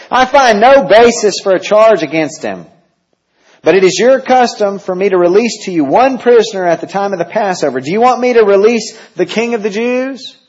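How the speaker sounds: high, loud governor's proclamation; Pilate to crowd. Acted. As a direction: announce it to the mob.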